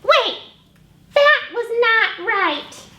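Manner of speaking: a bossy eager little pig, bursting; Piggy. Acted. Librarian voicing Piggy showing off a trumpet to Gerald.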